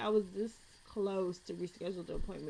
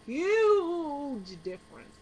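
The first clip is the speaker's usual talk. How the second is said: slow, loud, higher "huge difference"; emphatic; 6 semitones higher, 9 dB louder, much slower than usual. Real, not acted. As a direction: lean hard on "huge difference". Emphasising hair matters.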